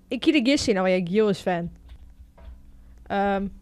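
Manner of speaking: ordinary talk, nothing marked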